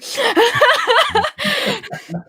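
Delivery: high, rolling burst of laughter; long high-pitched laugh; hearty laugh. Real, not acted. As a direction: laugh out at your own story. Laughs after her own story.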